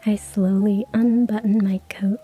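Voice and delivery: ordinary delivery, nothing marked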